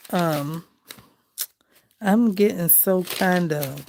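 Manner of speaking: usual speaking voice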